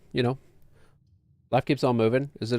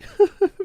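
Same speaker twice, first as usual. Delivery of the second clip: high laugh; 7 dB louder than usual. Real, not acted. Laughing at own joke.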